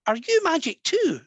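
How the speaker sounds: high-pitched imitation of a small child; little girl. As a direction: ask it like a curious little girl. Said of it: "the little girl looked at Dronma".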